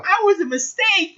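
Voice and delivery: put-on very high little-girl voice; imitated little girl. Retelling a Powerpuff Girls episode, imitated little girl. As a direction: squeak it out as a cartoon girl. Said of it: "the little girl, she's like".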